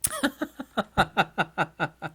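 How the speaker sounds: high chuckling laugh. Real, not acted. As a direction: laugh on at your own joke. Laughs at own joke.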